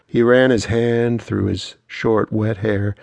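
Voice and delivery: usual speaking voice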